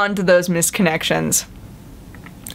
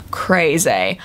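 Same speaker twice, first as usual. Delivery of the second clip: ordinary delivery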